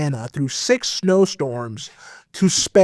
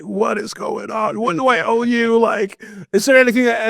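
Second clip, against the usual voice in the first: bewildered; 6 semitones higher than usual. Retold or imitated. His confused reaction retold.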